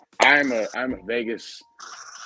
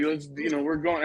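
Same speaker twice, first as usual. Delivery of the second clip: higher-pitched but soft eager chatter; imitated excitement; 6 semitones higher, 4 dB quieter than usual. Friend waking up near Vegas, mildly excited. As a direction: lightly mimic an excited travel buddy. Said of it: "he's just excited".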